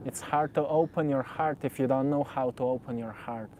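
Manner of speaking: usual speaking voice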